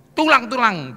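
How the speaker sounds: high, loud summons; prophet's loud call. Retold or imitated. Prophet calling to dry bones, loud summons. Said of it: "dia disuruh ngomong".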